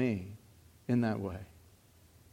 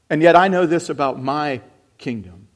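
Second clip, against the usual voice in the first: loud, driving sermon voice; fervent preaching; 3 semitones higher, 8 dB louder than usual. Acted. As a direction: preach it out to the crowd. Preaches at full force.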